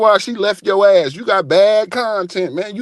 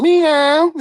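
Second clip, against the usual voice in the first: meow; 9 semitones higher, 3 dB louder than usual. Pretend. Cat sound.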